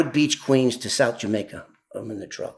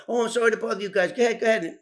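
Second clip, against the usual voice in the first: higher, fast, flustered apology; apologetic caller; 7 semitones higher, much faster than usual. Retold or imitated. Caller on the phone apologising to the couple, hurried and higher. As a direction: hurry off the phone, embarrassed.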